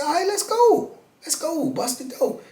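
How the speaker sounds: eager challenge. Retold or imitated. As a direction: accept the fight eagerly. Accepting the challenge, retold.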